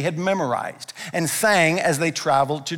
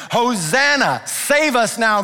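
praise cry; 4 semitones higher, slower than usual. Acted. Crowd's praise cry, preacher shouts.

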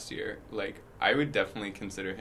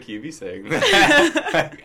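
laughing disbelief; 14 dB louder, much slower than usual. Retold or imitated. Shocked laughing quote.

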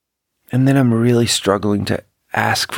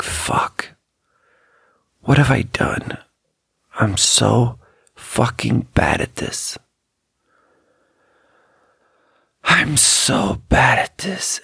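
hushed, slow self-reproach, barely voiced; slow hushed groan of self-blame; despairing whisper; 2 semitones higher, slower than usual. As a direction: whisper it to yourself in dismay. Inner panic at crying coworker.

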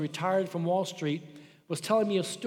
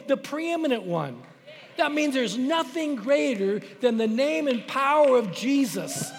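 rising, louder sermon build to the name; preaching at full force; 5 semitones higher, 4 dB louder than usual. Acted. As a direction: build the congregation up to the name of Jesus. Preaching climax about Jesus.

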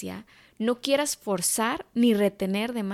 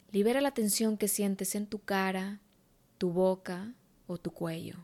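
guided meditation; 5 dB quieter than usual. Acted. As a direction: guide her gently into calm.

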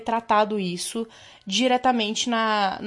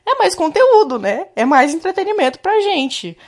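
excited; 7 semitones higher, 6 dB louder than usual. Real, not acted. Enthusing about more content.

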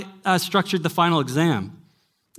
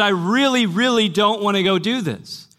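mock terrified; 5 semitones higher than usual. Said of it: "she was terrified and said".

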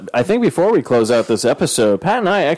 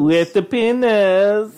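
slow mock; 9 semitones higher, much slower than usual. Mocking comment.

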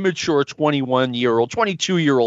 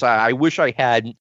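ordinary talk

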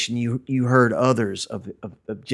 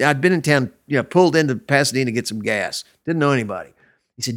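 ordinary talk

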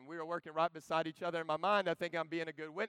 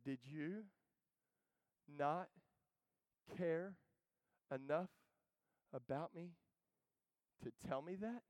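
tearful reproach; 8 dB quieter, slower than usual. Retold or imitated. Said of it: "lips quivering, tears rolling down his face".

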